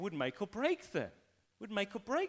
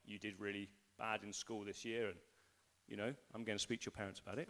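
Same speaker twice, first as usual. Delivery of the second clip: low, soft, understated teacher voice; quietly voiced teacher passing judgement; teacher reporting; 9 semitones lower, 12 dB quieter, faster than usual. Teacher telling a pupil about the school year, understated and low. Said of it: "So your teacher can say".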